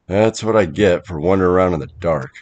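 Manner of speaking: ordinary delivery, nothing marked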